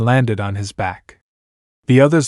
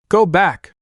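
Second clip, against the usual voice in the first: mild yell; 7 semitones higher than usual. A narrator's reading. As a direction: lightly call out an urgent order. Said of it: "she yelled as she deflected".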